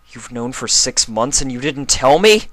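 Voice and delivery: rising upset question. Acted. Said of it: "his voice getting louder".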